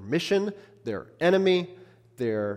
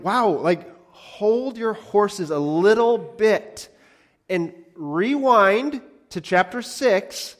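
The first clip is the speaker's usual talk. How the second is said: higher, louder and slow, drawn-out exasperation; exasperated hold-your-horses; 7 semitones higher, 7 dB louder, much slower than usual. Real, not acted. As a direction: stretch it out like calming an overeager crowd. Preacher reins in the disciples.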